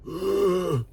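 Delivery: mock gasp of a sick friend; imitated gasp. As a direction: gasp like a weak patient. Imitating D-Lay.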